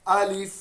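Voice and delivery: अलिफ said short and quick, not drawn out; don't stretch अलिफ, say it quickly; quick correct alif. Shown as a demonstration. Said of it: "जल्दी पढ़ना है".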